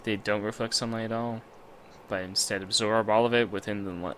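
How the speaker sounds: measured, low-key reading aloud; story narration. Acted. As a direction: narrate it as an inner monologue. Reads visual novel narration.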